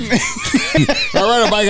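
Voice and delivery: slow, high laughing squeal of the words; giggling high-pitched repeat. Real, not acted. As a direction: laugh it out in disbelief at himself. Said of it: "repeated, laughing".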